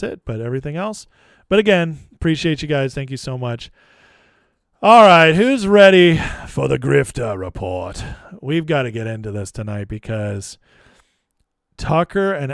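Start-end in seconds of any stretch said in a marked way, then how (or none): 4.8-8.0 loud host announcement; show intro call. Real, not acted. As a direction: kick off the segment like a show host. Streamer kicks off show segment.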